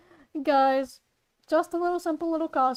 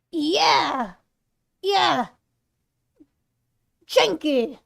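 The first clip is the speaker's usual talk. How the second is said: Frank's voice; 2 semitones higher, 5 dB louder, much slower than usual. Acted. Warming up to play Frank, loud energetic voice. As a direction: belt it out in short excited bursts. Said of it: "I gotta get the energy".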